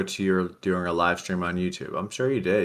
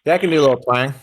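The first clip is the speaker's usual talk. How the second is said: louder, higher farmer twang; 6 semitones higher, 7 dB louder, much faster than usual. Shown as a demonstration. Co-host agrees to play a farmer, country twang. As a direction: answer in a put-on country twang. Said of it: "can you do a twang?".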